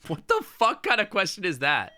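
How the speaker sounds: loud, high indignant outburst; outraged. Real, not acted. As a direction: shout it in disbelief.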